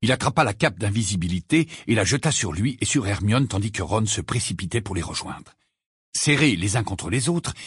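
ordinary delivery, nothing marked

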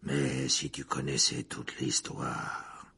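slow, low whisper; voiceless, low and slow. Acted. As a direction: lean in and whisper the secret.

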